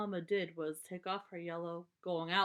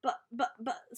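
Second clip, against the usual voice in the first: sputtering; 4 semitones higher, 4 dB louder, slower than usual. Acted. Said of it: "sputtered Brother".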